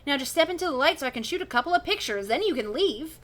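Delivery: put-on excited old professor. Professor character voice.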